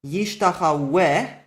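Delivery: yishtachaweh said slowly, the waw sounded as a consonant w; slow example word. Shown as a demonstration. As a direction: spell out yishtachaweh slowly, with a clear w before the final e. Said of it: "you pronounce it with a vowel".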